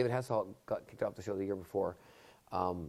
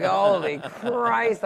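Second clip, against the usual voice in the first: drawn-out disbelief; 9 semitones higher, 8 dB louder, much slower than usual. Retold or imitated. Panicking as walk-on music starts.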